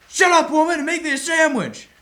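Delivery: dad yells back at mom, full shout; dad yelling. Retold or imitated. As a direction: roar it like an angry father. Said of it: "then he'd yell".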